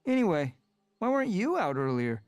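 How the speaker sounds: put-on high, lively young cat voice teasing a friend; high cat friend Smudge, teasing. A narrator's reading. Friendly cat teasing Rusty for oversleeping, high and bright. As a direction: Smudge chiding a sleepy friend, high and playful.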